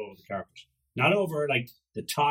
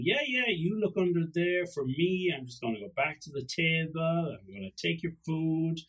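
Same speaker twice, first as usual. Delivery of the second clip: higher-pitched cheeky dog voice; playful, pitched-up pet character; scheming dog's thoughts; 5 semitones higher than usual. Acted. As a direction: voice a sly dog helping itself. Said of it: "The dog was thinking".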